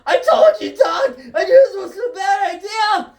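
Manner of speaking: high, loud panicked yell. Acted. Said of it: "Marty McFly yelling".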